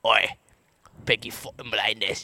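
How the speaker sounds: low gangster Brummie growl; Birmingham accent. Pretend. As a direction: spit it like a Shelby. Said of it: "Peaky Blinders".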